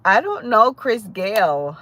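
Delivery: usual speaking voice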